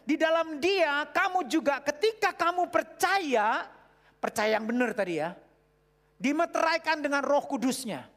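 fervent loud sermon reading; preaching at full force. Acted. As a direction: proclaim the verse to the crowd.

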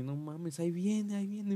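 agudo y alarmado, gritando; gritando ahí viene. Retold or imitated. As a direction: grita con pánico que alguien viene. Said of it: "empezaron a gritar". (gritando asustado)

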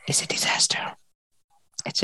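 breathy whispered dread; hushed dread. Real, not acted. Book podcaster whispering the disaster to her cohost. Dramatic aside about the mirror.

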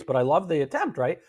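ordinary talk

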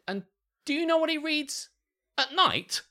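high-pitched imitation of mum; mum's teasing build-up. Mum quizzes Alex on dad's bedtime reading, high and teasing. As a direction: set up a big reveal, in mum's high voice. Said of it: "it was the way she said it".

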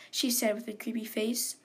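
ordinary delivery, nothing marked

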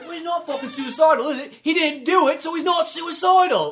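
high, loud exasperated rant; mock angry rant. Impression of Ray Fiennes' character.

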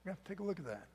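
normal talking voice

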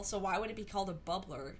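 usual speaking voice

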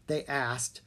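usual speaking voice

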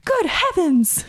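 high-pitched startled cry from a story; startled exclamation. A narrator's reading. Startled exclamation while reading aloud.

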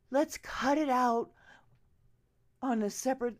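ordinary talk

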